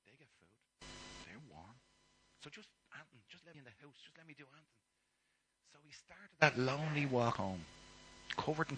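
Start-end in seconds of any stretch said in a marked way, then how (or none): 0.1-4.6 hushed, hesitant begging; quiet pleading. Acted. Son rehearsing his plea to his father, hushed. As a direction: beg under your breath.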